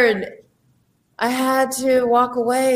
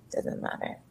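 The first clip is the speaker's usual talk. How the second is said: whisper; 15 dB quieter than usual. Real, not acted. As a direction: whisper it like a secret. Leans in to share a secret.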